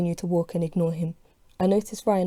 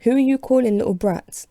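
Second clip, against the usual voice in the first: higher, louder furious demand; 5 semitones higher, 4 dB louder than usual. Acted. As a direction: defend the kids, voice raised. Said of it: "I'm furious now".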